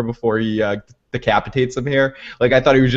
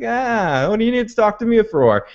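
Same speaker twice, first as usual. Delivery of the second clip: mock celebrating; 10 semitones higher than usual. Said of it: "like, celebrating here again".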